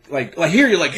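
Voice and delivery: normal talking voice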